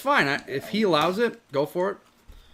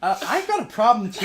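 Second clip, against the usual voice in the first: laughing; 5 semitones higher than usual. Real, not acted. All laughing at spicy heat.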